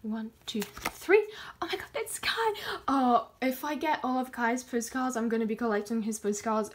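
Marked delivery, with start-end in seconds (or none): 0.6-1.3 the count jumps high and loud on three; spring the last number up like a reveal; excited reveal. Real, not acted. Counting down to open a photo card, excited jump on the last number. 1.6-2.5 high breathless whispered squeal; excited whisper. Real, not acted. Seeing [name]'s card, a high excited whisper. Pulls favorite member card.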